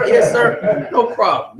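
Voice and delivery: high, bright, eager-to-please yes sir. Retold or imitated. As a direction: jump to attention for the boss on the phone. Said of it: "it's they boss".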